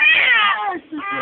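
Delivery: sudden loud high gasp of surprise; startled cry up high; surprised exclamation. Real, not acted. Baby's hiccup startles parent.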